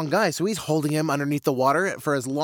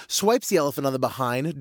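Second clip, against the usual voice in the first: ordinary delivery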